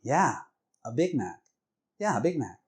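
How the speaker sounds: irritated, punchy confirmation; a little angry. Retold or imitated. Husband confirming his order to wife, a little angry. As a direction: repeat it with mild, impatient annoyance. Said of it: "He looks at her a little more angry".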